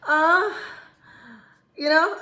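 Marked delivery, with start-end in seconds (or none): 0.1-0.5 short moan rising in pitch; put-on "uh" of pretend pleasure, higher than speech; faked moan. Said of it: "you will be faking it".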